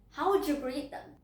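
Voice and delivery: ordinary talk, nothing marked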